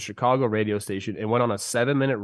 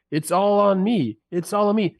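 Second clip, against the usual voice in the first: mocking a manager's self-blame, higher and slowed; 7 semitones higher than usual. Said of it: "just chastise people saying".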